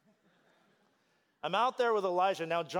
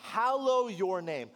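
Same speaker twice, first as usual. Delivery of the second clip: fervent preaching; 4 semitones higher than usual. Acted. Preaches slowly into prayer.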